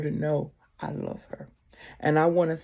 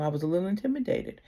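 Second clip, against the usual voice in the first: ordinary delivery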